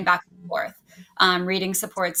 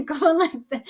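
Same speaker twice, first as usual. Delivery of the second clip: usual speaking voice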